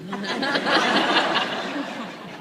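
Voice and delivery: loud laugh, high and bright; burst of laughter. Real, not acted. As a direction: let a big laugh break out. Audience-style laugh at own story.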